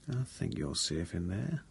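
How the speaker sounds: soft and slow, almost under the breath; quiet and slowed down; said quietly. Acted. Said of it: "I said, quietly".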